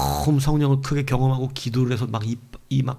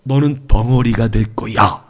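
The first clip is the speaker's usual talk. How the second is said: slow, lowered imitated threat; ominous pronouncement; 5 semitones lower, 3 dB louder, slower than usual. Preacher replaying the threat of becoming mute, slow ominous pronouncement. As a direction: deliver the curse deliberately, word by word. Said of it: "이렇게 하는데".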